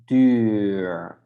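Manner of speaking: duur with a long French-u vowel; long Dutch UU. Shown as a demonstration. Teacher contrasts double U with single, long rounded duur. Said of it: "then we pronounce it totally different".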